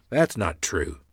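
ordinary delivery, nothing marked

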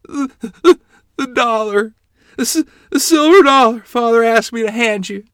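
high, loud, distraught stammer; panicked stammering. Acted. Child confessing to mother about the silver dollar, panicked. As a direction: stumble over the words in a child's panic.

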